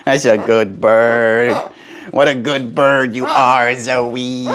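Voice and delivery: slow, drawn-out cooing praise; slow doting voice to a pet bird. Acted.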